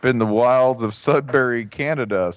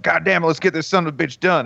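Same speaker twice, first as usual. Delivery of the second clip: higher-pitched Southern take-charge anger; mock angry Southerner; 7 semitones higher, faster than usual. Somebody walking in to take charge, mock angry Southern voice. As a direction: imitate an angry Southern boss. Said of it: "angry as hell and they're like".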